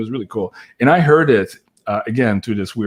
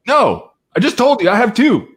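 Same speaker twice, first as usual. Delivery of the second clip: sharp, raised and louder, leaning in; angry retort; 11 semitones higher, 6 dB louder than usual. Retold or imitated. Woman at the fundraiser, irritated, correcting him firmly. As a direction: snap back at him, annoyed.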